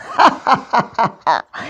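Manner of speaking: bully's mocking laugh, fading as he walks off; nasty laugh of a bully. A narrator's reading. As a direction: laugh at him cruelly. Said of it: "S'exclama Tyranno en s'éloignant".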